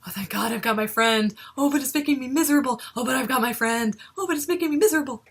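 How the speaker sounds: mock cheer cracking into rushed despair; fake-smile voice. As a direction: swing between relief and misery, smiling through it. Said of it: "that fake smile there was like".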